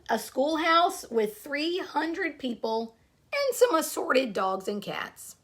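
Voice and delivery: storybook read-aloud to a class; story narration. Acted. Teacher reading a picture book to her class, storytelling voice.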